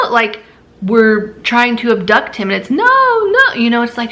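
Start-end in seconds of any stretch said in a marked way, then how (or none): 2.7-3.5 high, louder mock scream of no; imitating the kid's yelling protest, pitched up; mock child screaming. Mother imitating her son screaming no when told something. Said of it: "like, screaming".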